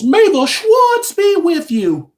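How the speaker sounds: high, slow, hammed-up wise-master voice; Spaceballs quote. Quoting Spaceballs for viewers, high and drawn out. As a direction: draw it out high like a movie mentor. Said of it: "in the words of the, uh, folks in Spaceballs".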